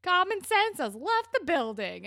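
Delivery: high, loud mock announcement; imitated mom's quip. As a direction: proclaim it like a mother landing a joke. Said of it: "her mom is like".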